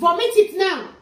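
high, very loud order; scolding shout. Acted. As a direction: yell it as an angry command. Mother orders child, scolding.

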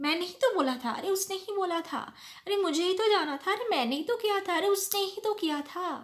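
high, rapid-fire Hindi complaints; imitating Hindi chatter. Teacher imitating how people often talk in Hindi, fast and high. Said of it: "translating from Hindi".

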